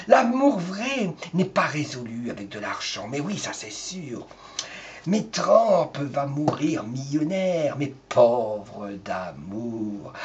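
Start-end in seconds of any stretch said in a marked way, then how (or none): 5.1-10.1 mock solemn. Solemn verdict on Trump.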